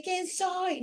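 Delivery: anguished question. Retold or imitated. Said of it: "como un dolor, de adentro para afuera".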